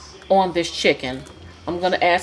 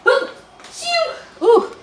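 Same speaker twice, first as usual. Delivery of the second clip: real sneeze with a sharp high burst; sneezing; 3 dB louder, much slower than usual. Real, not acted. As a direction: let a real sneeze out mid-sentence. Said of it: "Ooh, I have to sneeze".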